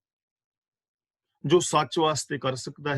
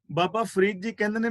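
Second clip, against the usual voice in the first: ordinary talk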